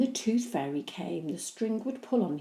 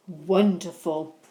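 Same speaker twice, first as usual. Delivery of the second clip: pleased; 8 dB louder, slower than usual. Acted. Dad pleased.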